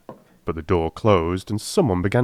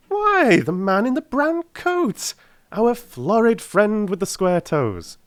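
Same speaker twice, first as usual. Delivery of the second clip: higher, slow, knowing detective; a pleased, higher-pitched sleuth explaining; Holmes voice; 13 semitones higher, slower than usual. A narrator's reading. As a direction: savour the answer like a clever detective. Holmes voiced in read story.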